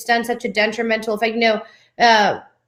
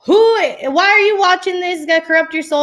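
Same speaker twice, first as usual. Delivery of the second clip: mock yell; 7 semitones higher, faster than usual. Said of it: "yelling and hollering and hooping".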